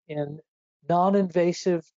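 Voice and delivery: ordinary talk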